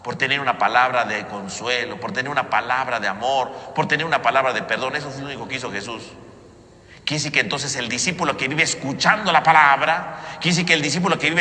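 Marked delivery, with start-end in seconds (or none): none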